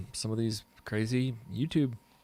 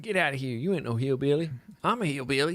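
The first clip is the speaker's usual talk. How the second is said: lightly put-on hillbilly accent, pitched up; mock hillbilly accent; 4 semitones higher, 4 dB louder than usual. Locals disowning a newcomer, in a mock hillbilly accent. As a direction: imitate hillbilly locals, rising higher as it goes. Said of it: "They'd be like".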